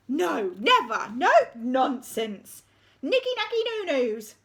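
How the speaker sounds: loud, slow protests; complaining teacher. Acted. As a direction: grumble it in protest.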